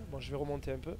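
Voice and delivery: ordinary delivery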